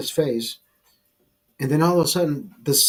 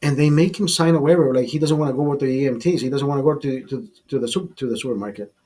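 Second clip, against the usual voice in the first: usual speaking voice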